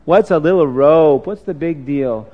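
dismissive voice of temptation, starting with a drawn "Ah"; tempting inner voice. Acted. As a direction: shrug off the rule, rising to a loud "but". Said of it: "Another voice came in his head".

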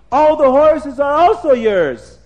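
high, loud, stretched-out proclamation; grand announcement. A narrator's reading. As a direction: proclaim the big surprise slowly.

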